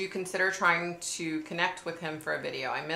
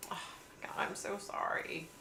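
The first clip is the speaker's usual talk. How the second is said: whispered sympathy; 3 semitones higher, 9 dB quieter than usual. Real, not acted. Whispering her sorrow to a viewer whose dad just died. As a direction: breathe it out gently, barely voiced.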